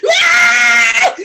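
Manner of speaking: very high piercing wail; the fallen eagle howls; shrill eagle cry. Acted. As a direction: let out a high screeching cry. Eagle cries loudly.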